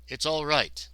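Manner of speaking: usual speaking voice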